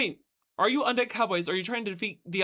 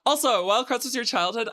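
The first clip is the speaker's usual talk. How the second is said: ordinary delivery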